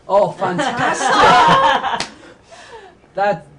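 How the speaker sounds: delighted laughing exclamation; real laughing delight. Real, not acted. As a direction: burst out laughing as you say it. Delighted reaction to animated art.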